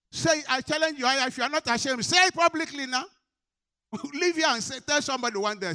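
high, loud preaching shout; impassioned challenge. Real, not acted. Preacher daring the congregation to testify publicly, impassioned shout. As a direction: cry out a challenge at full force.

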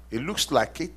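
ordinary talk

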